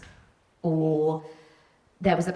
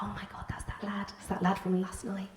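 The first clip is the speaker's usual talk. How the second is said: whispered, secretive retelling; hushed realisation; 8 dB quieter than usual. Retold or imitated. Girl seeing missed calls from last night's lad, hushed whisper. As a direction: whisper it to yourself as it dawns.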